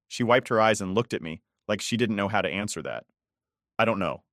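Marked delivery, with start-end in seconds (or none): none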